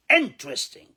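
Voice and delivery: Interesting said without the E after the T; three-syllable interesting. Shown as a demonstration. Teacher showing students the dropped E in Interesting. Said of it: "ال E اللي بعد ال T drop it".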